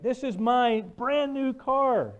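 high, loud outraged shouting; a driver yelling at the boy over his new Jaguar; angry rant. Retold or imitated. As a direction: let the fury spill out over the dented car. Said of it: "Angry, right?".

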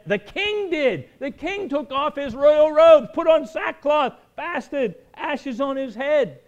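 high, loud and slow, ringing out the king's repentance; full-force sermon peak as the king strips off his robes; preaching climax. Acted. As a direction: lift the whole passage up and let it ring. Preacher building over the king.

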